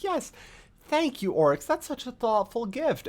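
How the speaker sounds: lightly voiced, overly courteous sister; gracious Savathun. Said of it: "And she's like".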